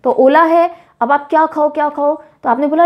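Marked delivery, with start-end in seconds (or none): none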